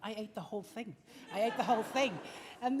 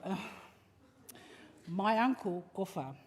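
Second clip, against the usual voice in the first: normal talking voice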